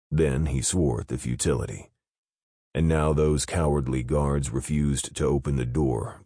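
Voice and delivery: ordinary delivery, nothing marked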